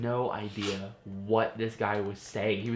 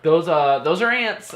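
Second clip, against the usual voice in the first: higher, louder, stunned disbelief; shocked realization; 5 semitones higher, 8 dB louder than usual. Retold or imitated. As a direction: spot the ants and gasp it out. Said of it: "I was like".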